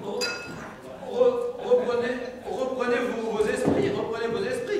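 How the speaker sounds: loud, pressing plea to calm down; insistent urging. Acted. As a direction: push the words out loud and urgent. Urging a panicked man.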